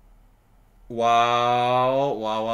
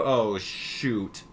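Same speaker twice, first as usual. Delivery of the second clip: usual speaking voice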